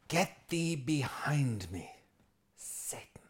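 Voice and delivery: slow, grave, fading to a whisper on Satan; Jesus slowly rebuking his student, sinking to a whisper; solemn rebuke. Retold or imitated. Jesus rebuking Peter, slow and grave, ending whispered.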